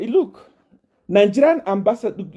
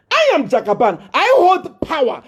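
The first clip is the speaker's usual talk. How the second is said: boastful proclamation; 9 semitones higher than usual. Real, not acted. Boasting of his power.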